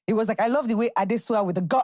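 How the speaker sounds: usual speaking voice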